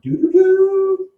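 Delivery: high, loud, drawn-out three-note honk; playful horn imitation, high and long; sung car horn. Imitating Gatsby's three-noted horn.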